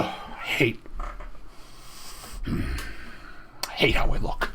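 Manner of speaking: slow, embarrassed whisper; whispered self-pity. Real, not acted. Streamer whispers about hating his own photo, slow. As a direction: breathe it out ashamed, slowly.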